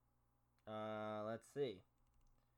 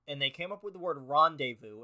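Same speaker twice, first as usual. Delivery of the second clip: normal talking voice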